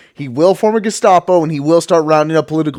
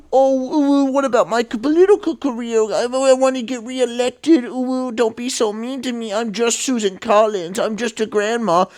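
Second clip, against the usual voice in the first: high, breathy mock whimper of a self-pitying politician; mocking whiny senator; 8 semitones higher than usual. Mocking a senator in a high whiny voice. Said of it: "And it's just like".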